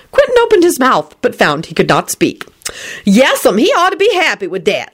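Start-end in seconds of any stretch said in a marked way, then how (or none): 3.0-4.9 high, hearty agreement; agreeable. A narrator's reading. Lucretia backing up Solange, high and agreeable. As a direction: agree with gusto. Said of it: "Lucretia replied agreeably".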